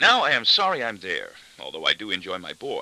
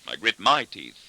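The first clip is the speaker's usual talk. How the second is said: ordinary delivery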